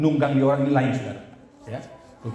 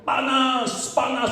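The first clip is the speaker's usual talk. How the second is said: high-pitched mock yell; retold cry; 11 semitones higher, much slower than usual. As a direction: imitate someone crying out as they burn. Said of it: "lama-lama dia mulai teriak".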